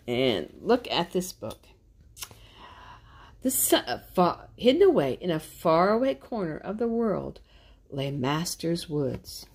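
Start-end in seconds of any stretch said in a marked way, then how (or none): 3.4-9.5 put-on louder, lively read-aloud to kids; animated storybook narration; story narration. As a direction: read it like a picture book for little ones. Reads picture book aloud.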